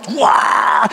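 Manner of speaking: high excited shout of wonder; hammed-up amazed cry; mock wow. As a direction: shout it out in delight. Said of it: "막 이럴 줄 알았어요".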